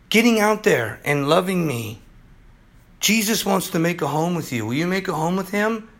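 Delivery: usual speaking voice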